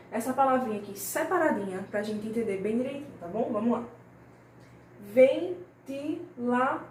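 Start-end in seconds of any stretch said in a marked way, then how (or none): 5.1-6.8 Ventilador slowly split as VEN-TI-LA-DOR, higher pitch; slow, raised teacher's dictation of "Ventilador"; syllable by syllable. Shown as a demonstration. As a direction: break Ventilador into four separate syllables. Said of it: "a palavra separada por sílabas".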